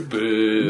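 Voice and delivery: mock heckle, "Boo" held long; Scottish booing. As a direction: imitate a crowd's long "Boo". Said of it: "Scots would go".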